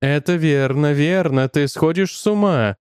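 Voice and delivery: ordinary delivery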